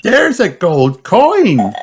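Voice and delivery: high, bright little pig voice; high child voice; Peppa's voice. Acted. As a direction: play the excited piglet. Peppa's lines in a children's story.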